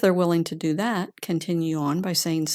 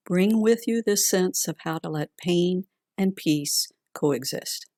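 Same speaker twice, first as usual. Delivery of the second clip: calm grounding exercise; 3 dB quieter than usual. Acted. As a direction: gently guide them inward, unhurried. Leads a heart meditation.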